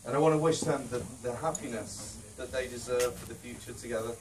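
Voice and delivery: ordinary talk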